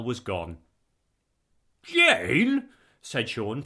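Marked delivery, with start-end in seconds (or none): 1.8-2.7 high, loud protest on a name; indignant, high-pitched exclamation. A narrator's reading. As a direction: call out to your wife in protest.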